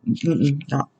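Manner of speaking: ordinary talk, nothing marked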